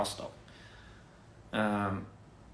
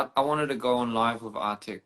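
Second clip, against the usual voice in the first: ordinary talk, nothing marked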